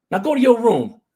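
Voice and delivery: high, loud command; dad's angry order. Retold or imitated. Dad orders his punished son away, loud and angry. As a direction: send him off with a shout. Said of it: "he spanked me".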